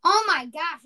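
loud, exasperated burst; outraged exclamation. Real, not acted. Shopper reacting to an outrageous puck price, loud outrage. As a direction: exclaim it loudly in disbelief.

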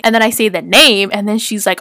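ordinary talk, nothing marked

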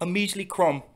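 mocking English sneer; mock English accent. Taunting his friend in a mock English accent. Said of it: "our terrible English accents".

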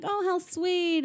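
high, soft, drawn-out coo; mock cooing. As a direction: imitate onlookers gushing softly. Acting onlookers cooing.

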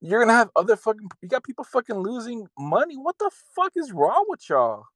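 high-pitched furious rant; angry. Real, not acted. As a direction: yell it out in outrage. Angry rant about Congress.

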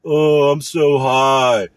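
mock stoned taco worker, very slow; stoner voice. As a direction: stretch it out like a baked stoner. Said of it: "probably on fucking high".

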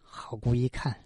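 ordinary delivery, nothing marked

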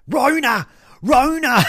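high, loud imitation of a screaming old woman; mock screaming. Old bird behind me in the shop, mock screaming. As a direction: shriek it like an accusing shopper. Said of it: "screaming at me".

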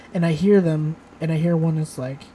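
ordinary delivery

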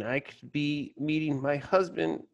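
a touch of mock weeping, drawn out; mock crying. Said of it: "When she starts crying and saying".

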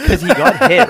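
high, loud laughing words; laughing it out as he tells it. Real, not acted. To the co-hosts, laughing hard and high. Laughing while retelling the play.

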